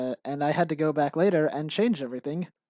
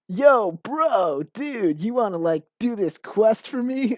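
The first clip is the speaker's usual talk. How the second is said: higher, louder laid-back bro voice; bro character; 7 semitones higher, 7 dB louder, slower than usual. Retold or imitated. Old quest character asking the player in a hammed-up bro voice. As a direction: sell it like a goofy surfer dude. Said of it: "the character was like".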